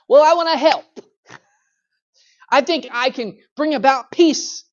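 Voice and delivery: high, loud eager would-be helper; eager meddler. Acted. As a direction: play the busybody who wants to help. Meddler's voice acted in sermon.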